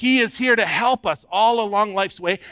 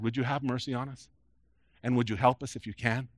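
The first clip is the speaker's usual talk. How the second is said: quiet plea; 6 semitones lower, 9 dB quieter than usual. Retold or imitated. Father pleads with Jesus.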